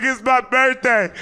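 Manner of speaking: high, loud, drawn-out comic whine; mock wail. Teen ordered out of car on birthday, mock wail. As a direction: wail it slowly like a hammed-up sob. Inner wail while being arrested.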